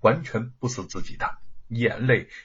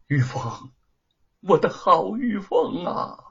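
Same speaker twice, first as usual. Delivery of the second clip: tearful; 7 semitones higher, slower than usual. Acted. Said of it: "他眼含热泪".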